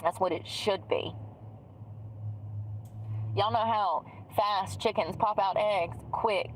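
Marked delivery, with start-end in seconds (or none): none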